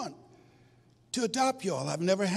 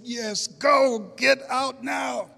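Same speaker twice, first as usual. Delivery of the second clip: loud, urgent order to leave now; urgent shout; 7 semitones higher, 6 dB louder, much slower than usual. Retold or imitated. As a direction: shove them out the door with your voice. Birth mother urges them away.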